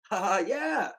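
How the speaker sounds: loud, high mock laugh and cheer. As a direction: shout a hammed-up delighted "yeah".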